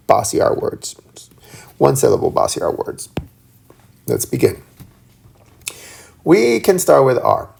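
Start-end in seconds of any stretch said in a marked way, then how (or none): 0.1-4.6 soft whispered aside; whispering. Real, not acted. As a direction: whisper it to the class.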